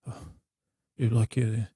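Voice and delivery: ordinary talk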